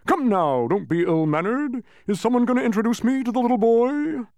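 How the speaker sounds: high, loud demanding bluster; blustering Humbug. A narrator's reading. Humbug demands introduction to the boy, loud bluster. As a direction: demand attention loudly.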